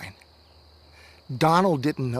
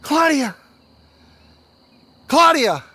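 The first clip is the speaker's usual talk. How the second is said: high, loud, desperate calls building; calling out; 8 semitones higher, 7 dB louder than usual. Acted. Lost boy calling for toddler in sleet, shouting. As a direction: yell her name louder each time. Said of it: "and he calls out".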